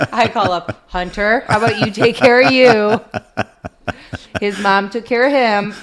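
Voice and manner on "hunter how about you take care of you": calling upstairs. Retold or imitated. Calling up the stairs to son.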